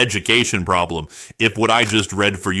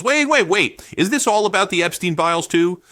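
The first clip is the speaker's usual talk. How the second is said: mock surprise; 7 semitones higher than usual. Mock alarm at Epstein link.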